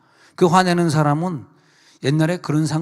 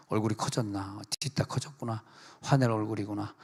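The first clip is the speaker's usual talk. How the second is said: soft teasing; 4 semitones lower, 9 dB quieter than usual. Retold or imitated. Pastor mimics looking at neighbor.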